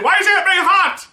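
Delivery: very high, loud mock shriek; mock outraged yell. Imitating moviegoers' complaint about Aunt May, mock outraged yell. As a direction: yell it as an over-the-top outcry. Mimics people's outcry about casting.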